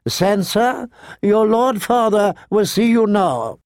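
high-pitched, formal governess voice; septa calling. A narrator's reading. Septa summons Sansa to her father, calling. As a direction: summon a child through a closed door.

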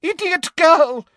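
high, exasperated outburst; angry shouting. A narrator's reading. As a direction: scold at high pitch. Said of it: "shouted Umbridge".

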